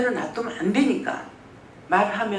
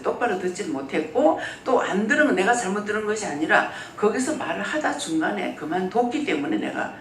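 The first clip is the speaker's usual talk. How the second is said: usual speaking voice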